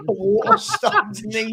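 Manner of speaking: high, drawn-out words through laughter; laughing. Real, not acted. Guest cracking up at a chat comment, laughing. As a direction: crack up while talking. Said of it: "That made me laugh".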